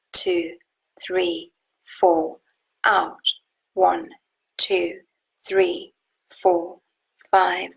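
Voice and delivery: slow, steady counting of breaths; guided breath count. Acted. Teacher counting breaths for the group, slow and steady.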